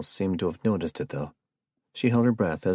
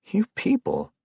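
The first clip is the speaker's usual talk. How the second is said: much higher, exasperated cry of disgust; 3 dB louder than usual. Acted. Jared, disgusted with his friends, cries out in exasperation. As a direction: throw up your hands at them in mock despair. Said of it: "eyeing them all with disgust".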